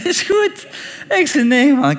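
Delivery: loud, high-pitched mock protest; exasperated retort. Storyteller replaying his own indignant answer, exasperated.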